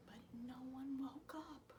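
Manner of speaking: unvoiced whisper. A narrator's reading. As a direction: whisper the letdown. Whispered narration.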